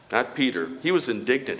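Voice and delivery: ordinary delivery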